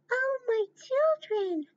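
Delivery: mommy pig. Acted. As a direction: sing out to the piglets like a fond mother pig.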